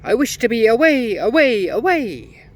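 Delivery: very high, very loud chanted shout; gnome shouting. A narrator's reading. Gnome shouting his spell as he snatches Fenella, loud. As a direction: bellow the spell out. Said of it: "shouted loudly".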